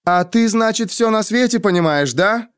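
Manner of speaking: high-pitched needling question; sarcastic inquiry. A narrator's reading. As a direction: challenge him with mock politeness. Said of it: "осведомилась Денна". (язвительно, высоким голосом)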